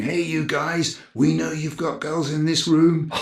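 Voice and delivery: security guard warning. Retold or imitated. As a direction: call out like a guard banging on the door. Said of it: "security guards are knocking on the door saying".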